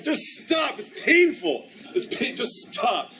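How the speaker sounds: high-pitched imitation of a pained wife; mock pained pleading. Wife begging husband to stop flirting with her, mock pained. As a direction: plead comically, high and then dragging.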